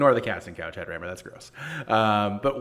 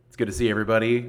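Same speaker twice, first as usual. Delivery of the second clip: ordinary delivery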